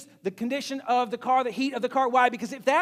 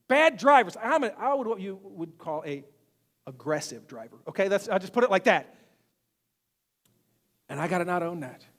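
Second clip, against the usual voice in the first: ordinary talk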